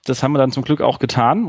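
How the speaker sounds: ordinary delivery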